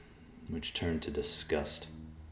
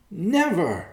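high, loud defiant cry; 8 dB louder than usual. A narrator's reading. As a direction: fling the refusal out. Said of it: "Yolana cried".

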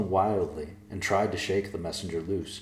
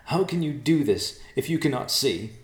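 higher, louder, incredulous; incredulous young woman; 8 semitones higher, 5 dB louder than usual. Acted. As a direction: demand it in disbelief. Yolana challenging.

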